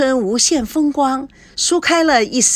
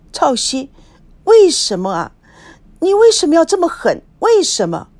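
a touch of tearful pleading, higher and louder; mild acted crying; 6 semitones higher, 4 dB louder than usual. Wife crying out at her husband on TV, a touch tearful. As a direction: cry out why at him, hurt. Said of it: "孙娜突然痛哭起来".

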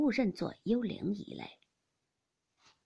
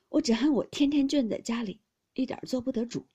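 put-on raised, aggrieved young man's voice; frustrated complaint; 3 semitones higher, 7 dB louder than usual. A narrator's reading. Baoyu complaining he is shut up at home, frustrated. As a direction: push out the grievance, starting loud.

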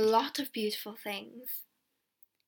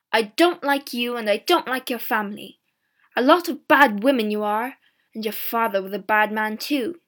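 angry scolding; 8 dB louder than usual. A narrator's reading. Peddler insults girl in quarrel.

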